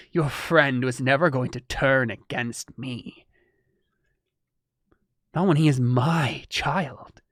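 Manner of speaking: slow, deliberate villain voice; slow taunting villain voice; villain's taunt. Acted. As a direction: coldly reveal the twist, slowly.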